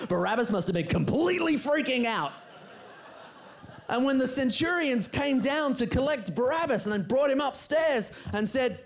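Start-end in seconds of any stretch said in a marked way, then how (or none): none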